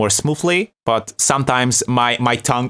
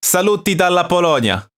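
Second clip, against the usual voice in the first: Italian greeting; 6 semitones higher than usual. Shown as a demonstration. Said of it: "it's in Italian".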